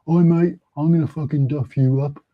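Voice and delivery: imitated Brummie hard man, not very threatening; Birmingham accent. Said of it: "he said in a Birmingham accent".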